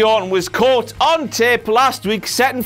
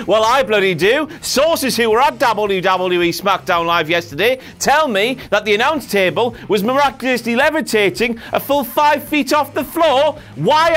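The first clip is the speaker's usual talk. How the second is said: ordinary talk, nothing marked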